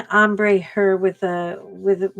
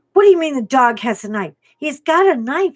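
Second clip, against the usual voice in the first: higher, louder startled question; mock-alarmed mom in disbelief; 6 semitones higher, 5 dB louder than usual. Mom hearing the dog has a knife, raised startled voice. Mom's alarmed retold reaction.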